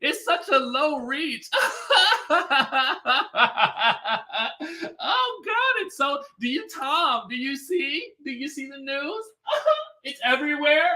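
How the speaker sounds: high, laughing freak-out; mock panic through giggles; giggly Drew. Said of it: "Drew is laughing or smiling the entire time".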